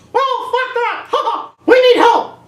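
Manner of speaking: high squeaky cartoon panic; mock Mickey panic. Mickey begging for help, high squeaky panic. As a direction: shriek for help like a cartoon mouse.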